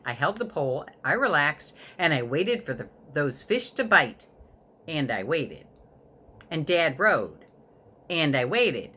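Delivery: ordinary delivery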